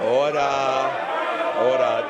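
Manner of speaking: loud sharp call for order; shouting order order. Real, not acted. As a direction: bark order to silence the chamber. Speaker calls rowdy House to order.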